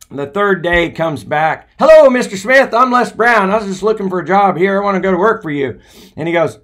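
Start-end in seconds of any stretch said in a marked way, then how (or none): 1.8-5.8 much higher, louder, rushed pitch; eager young Les. Retold or imitated. Les on the third day, pitching himself to the manager, eager. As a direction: barrel in full of hope.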